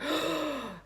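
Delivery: sharp gasp at her own words; big imitated gasp of shock; re-enacted shocked gasp. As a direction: gasp as if horrified by what slipped out. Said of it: "gasped at myself".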